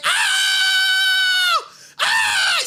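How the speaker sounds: piercing high screams; two shrill horrified screams; retold screaming. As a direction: shriek in horror, full voice. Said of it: "Just screaming".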